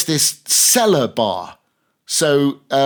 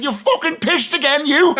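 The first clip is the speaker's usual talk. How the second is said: high, mock scolding wife; a wife berating her drunk husband, high mock scold; 13 semitones higher than usual. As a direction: tell off the drunk husband in a shrill-pitched mock voice. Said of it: "They said".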